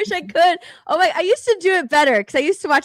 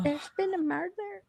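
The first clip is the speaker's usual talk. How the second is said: soft, laughing Scottish accent attempt; strained Scottish accent try; 5 semitones higher, 9 dB quieter than usual. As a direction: squeeze out a Scottish murder line. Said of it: "I'm really trying to".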